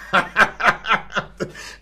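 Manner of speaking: wheezy villain cackle; Skeletor laugh. Real, not acted. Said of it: "va Skeletor riéndose".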